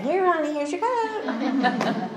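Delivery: high, sugary mock-sweet call, slowing with a laugh. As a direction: pretend to be the doting grandma, sweetly embarrassing. Imagined sweet call to grandson.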